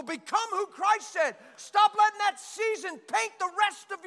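loud, high fiery preaching, shouting key lines; full force preaching. Acted. Preacher shouting at the congregation to leave the past, fiery. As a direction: thunder it at the congregation.

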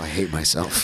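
low, soft aside of defeat; mock self-loathing. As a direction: drop it low and quiet, disgusted with yourself. Retelling his self-disgust after laughs.

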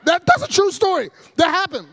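high, loud protest of truth; emphatic insistence. Real, not acted. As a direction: insist loudly over the audience's reaction. Insisting the absurd story is true.

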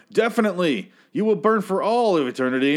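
high, emphatic zealot voice; mock doom pronouncement. Acted stranger confirming seatmate will burn, high mock-preachy certainty. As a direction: pronounce damnation with total certainty.

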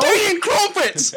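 very high, loud, drawn-out British monster; screaming British caricature. Acted. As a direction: roar it slowly as a crazed Brit. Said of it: "we just turn into British monsters".

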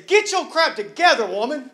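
a sharp, loud, high rebuke; mock scolding. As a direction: snap at her like a stern judge. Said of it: "instead of looking at her and say".

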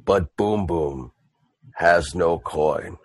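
Boom Boom. Acted. Half-orc admits to the dwarves he has no coin, ponderous. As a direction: drop low and plod, with long pauses. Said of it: "Boom Boom goes, he goes".

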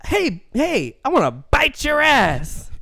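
high, slow, exaggerated brash come-on; hammed-up Chris Rock pickup; Chris Rock impression. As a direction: drag it out like a hammed-up comic. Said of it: "Chris Rock impression".

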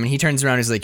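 ordinary talk

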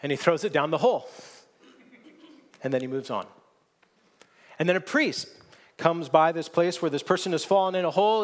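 normal talking voice

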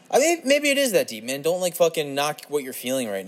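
high, loud burst of encouragement; excited pep talk. Real, not acted. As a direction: snap out of it and hype yourself up. Sudden self pep talk.

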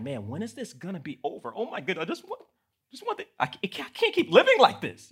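exasperated complaining that builds; mock frustrated whine. Complaining about gas prices, a rising whiny wail. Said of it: "and I'm like".

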